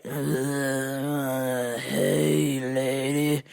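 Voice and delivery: low, slow zombie drone. Acted. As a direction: groan it out like the undead, slowly. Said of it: "coming out of the bushes like they were zombies".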